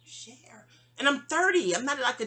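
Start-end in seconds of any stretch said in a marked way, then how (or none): 0.0-0.7 whispered in disbelief. Retold or imitated. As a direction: breathe it out unvoiced. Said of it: "I'm like".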